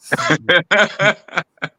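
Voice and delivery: bright giggle after sneezing; high laugh after sneeze. Real, not acted. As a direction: laugh it off lightly.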